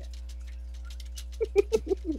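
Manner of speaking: high, quiet giggling; soft, airy run of laughter; real soft giggle. Real, not acted. Listener enjoying the shared game, quiet giggle.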